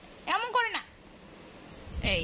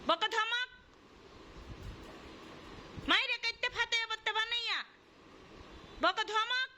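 mock scolding prompt; 8 semitones higher than usual. Mother prompting baby to scold.

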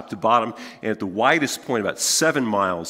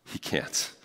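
whispered aside; 10 dB quieter than usual. Real, not acted. Rueful repeat after laugh.

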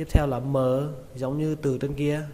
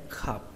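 "khập" low, soft and short; dropped falling tone; 4 semitones lower, 8 dB quieter than usual. Shown as a demonstration. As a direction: let "khập" fall sharply in pitch. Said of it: "Xuống giọng các bạn nhé". (xuống giọng ở "khập")

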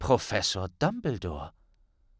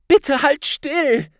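high, loud and slow plea; girl pleading with a struggling friend; urgent Hermine; 14 semitones higher, 8 dB louder, slower than usual. A narrator's reading. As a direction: urge him to hold still. Hermine urges Harry.

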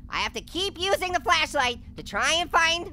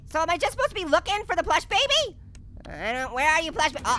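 normal talking voice

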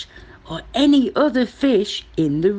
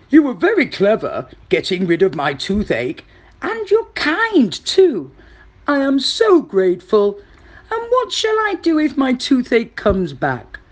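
crocodile character rising higher with gratitude; grateful crocodile; 5 semitones higher, 4 dB louder than usual. Acted. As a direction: thank the mouse in a big crocodile voice.

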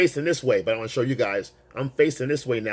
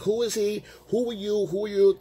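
lightly mimicked woman, pitched up; woman at the bar; 9 semitones higher than usual. Woman questioning his man, mock challenging. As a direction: imitate her demanding to know, higher. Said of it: "she's talking, saying something like".

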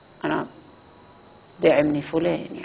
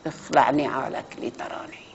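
slow, soft whisper of a voice from beyond; hushed divine reply; 2 semitones lower, 7 dB quieter, slower than usual. Acted. God answering Abraham in a slow hushed whisper. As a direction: breathe the command slowly, almost voiceless.

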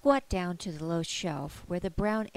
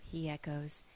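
ordinary delivery

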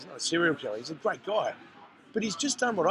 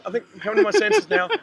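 much higher, louder dazed relief; shaky relief; 12 semitones higher, 4 dB louder than usual. Retold or imitated. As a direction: say it as if just surfacing.

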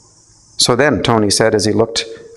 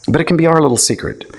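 conspiratorial; 7 semitones higher, faster than usual. A narrator's reading. Ego confiding conspiratorially.

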